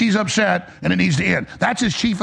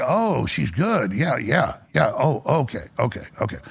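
a drowsy, lower Trump brushing it off; imitating Trump; 6 semitones lower than usual. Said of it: "And Trump's like".

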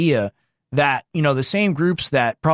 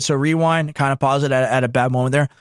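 ordinary talk, nothing marked

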